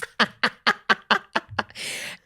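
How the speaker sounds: high, airy run of laughter; long giggle. Real, not acted. Shopper amused by her own spree, giggling. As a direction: giggle helplessly, high and drawn out. Giggles after buying niece a dress.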